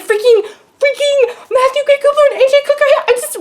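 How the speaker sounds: high, loud fangirl squeal; freaking out. Retold or imitated. Fan seeing two stars together, freaking out. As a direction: stammer the first word, then rush it out high. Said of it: "I'm freaking out".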